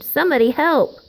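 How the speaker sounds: a storybook cry for help, pushed out; screamed. Acted. Rabbits scatter and scream for help, a mock scream. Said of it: "they screamed".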